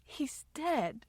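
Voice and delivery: small, sorrowful voice; soft crying. A narrator's reading. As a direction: barely get the sad news out. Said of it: "she cried softly".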